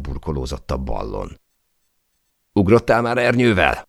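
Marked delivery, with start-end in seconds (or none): 2.5-3.9 high, raised-voice yell to a companion; yelled question. A narrator's reading. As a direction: yell the question out over the din. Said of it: "ordítottam Alexnek". (kiabálva feltett kérdés)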